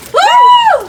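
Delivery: high loud whoop; cheering woo. Real, not acted. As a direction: let out a big cheer. Cheer during game.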